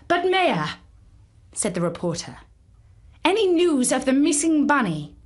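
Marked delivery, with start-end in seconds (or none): 0.1-0.8 higher, louder call to the mayor. A narrator's reading. Reporter calls out to the mayor, higher and louder. 3.2-5.2 higher, louder eager question; pressing question. A narrator's reading. Reporter presses the mayor, higher and louder.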